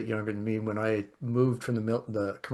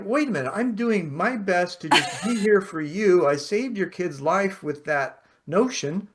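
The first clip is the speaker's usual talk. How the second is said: retold protest; 6 semitones higher, 3 dB louder than usual. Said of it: "And I'm like".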